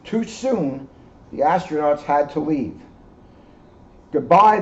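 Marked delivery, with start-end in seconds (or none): 4.1-4.6 high, loud goodbye call; fond farewell. Acted. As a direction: wave them off warmly. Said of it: "the moon said".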